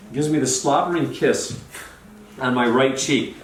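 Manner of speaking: ordinary delivery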